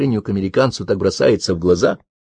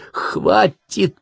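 hissed; 11 semitones higher than usual. A narrator's reading. Karen stopping her teasing friend, hissing. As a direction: cut her off with a high hiss. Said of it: "прошипела Карен".

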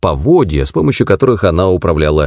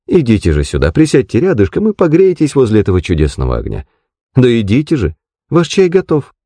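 higher, swooping landlady voice; doting, insistent landlady; 6 semitones higher than usual. Acted. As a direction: contradict sweetly, then coax the guest over. (хозяйка высоким голосом, ласково настаивает)

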